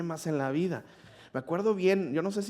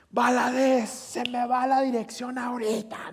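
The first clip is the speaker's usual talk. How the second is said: mock angry teacher; 9 semitones higher, slower than usual. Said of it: "Y me grita".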